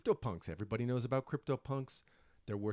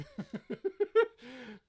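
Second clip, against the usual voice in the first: high laugh; 12 semitones higher than usual. Real, not acted. Laughs at own mistake.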